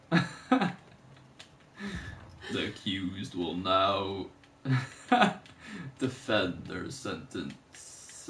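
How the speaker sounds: low, slow mock-official pronouncement; Avatar character voice. Quoting a half-remembered Avatar guy, low slow voice. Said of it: "I think of the Avatar voice".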